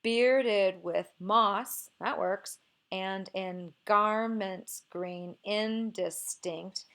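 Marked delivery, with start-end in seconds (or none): 0.0-1.9 very slow, stretched beats on BEARded and MOSS; scanning the meter. Shown as a demonstration. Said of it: "Let's see what this one looks like". 2.9-6.8 very slow scansion, beats on GAR in garments and IN in indistinct; deliberate metrical sounding-out; forced dactylic pattern. Shown as a demonstration. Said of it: "I kind of forced a little bit of this pattern".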